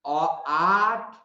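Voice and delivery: आठ stretched out very slowly; exaggerated slow vowel model; very slow demo. Shown as a demonstration. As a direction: draw out आठ syllable by syllable. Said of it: "T ही दिया हुआ है सब में".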